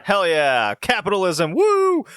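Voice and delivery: fake-cheer it like a fan at a rally; mock cheer. Joking about the pro-capitalist game plot, mock cheering.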